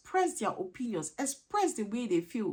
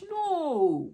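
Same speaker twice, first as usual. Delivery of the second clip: high, louder no; a raised, firm no; emphatic no; 7 semitones higher, 6 dB louder than usual. Real, not acted. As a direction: firmly reject the example. Rejecting the quoted parent.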